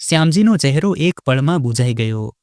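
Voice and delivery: usual speaking voice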